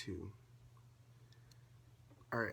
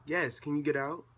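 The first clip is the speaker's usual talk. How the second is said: loud, raised-pitch order to leave; real annoyed yell; 6 semitones higher, 11 dB louder than usual. Real, not acted. Vlogger to intruder mid-recording, annoyed shouting. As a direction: yell it at someone interrupting you.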